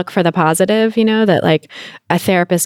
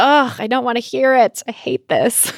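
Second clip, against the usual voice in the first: whiny groan; 7 semitones higher than usual. Retold or imitated. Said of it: "sometimes it's just like".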